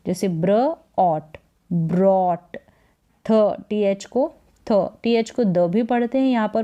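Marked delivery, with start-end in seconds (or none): 1.0-2.6 ब्रॉट said slowly, ब्र blended into ऑट; slow teacher's blend ब्र ऑट, ब्रॉट; brought demo. Shown as a demonstration. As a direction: sound out ब्र and ऑट, then join them as ब्रॉट. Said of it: "ब्र करके pronounce करेंगे".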